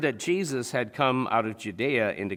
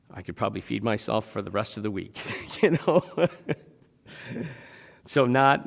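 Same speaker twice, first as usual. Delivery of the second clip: normal talking voice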